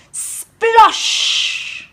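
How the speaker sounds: breathy voiceless splash noise; splash sound. A narrator's reading. Shoes landing in the river, a voiceless splash. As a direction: make the water go splosh.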